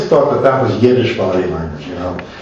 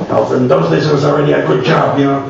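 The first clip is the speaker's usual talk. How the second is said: light imitation of an old Yiddish immigrant accent, a little higher; 5 semitones higher than usual. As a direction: gently mimic an elderly Yiddish-accented voice from Miami. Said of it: "you don't hear that accent anymore".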